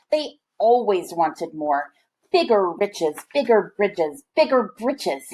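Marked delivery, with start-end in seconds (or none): none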